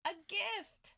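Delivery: high, slow, starry-eyed joy; gushing delight. Retold or imitated. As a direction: swoon over the gift. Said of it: "I'm like".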